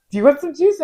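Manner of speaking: high, loud cheery offer of juice; sweet offer. Retold or imitated. Nurse imitating a coworker offering juice to calm patients, high and loud. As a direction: brightly offer juice after the yelling. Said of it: "just be like".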